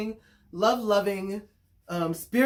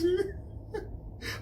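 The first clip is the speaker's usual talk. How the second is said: very high, breathy giggle; high squealing laugh; 13 semitones higher, 5 dB quieter than usual. Real, not acted. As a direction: let a squeal of laughter escape. Laughs at her own rant.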